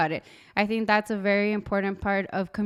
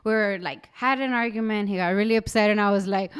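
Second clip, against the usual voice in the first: ordinary talk, nothing marked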